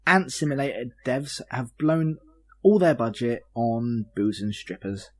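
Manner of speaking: normal talking voice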